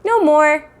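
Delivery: put-on high, loud little-kid echo; toddler echo. Fudge repeating mother's words, high toddler echo. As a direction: parrot it like a toddler.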